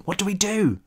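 soft, high, barely voiced question; mouthed. A narrator's reading. Cal mouthing silently to his friends, near-silent. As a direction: mouth it without sound.

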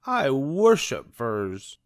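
slow theatrical Cruella gush; exclaiming Cruella. A narrator's reading. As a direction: gush it like a fur-mad diva. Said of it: "she exclaimed".

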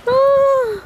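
high sharp yelp from the cold water; cold shock yelp. Real, not acted.

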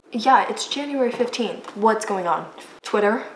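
normal talking voice

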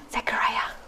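exaggerated whisper to a mute man. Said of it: "It's like".

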